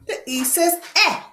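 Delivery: ordinary talk, nothing marked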